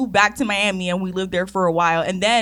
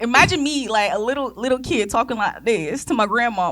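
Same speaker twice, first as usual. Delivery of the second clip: usual speaking voice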